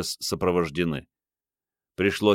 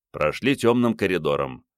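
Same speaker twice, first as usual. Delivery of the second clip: ordinary delivery, nothing marked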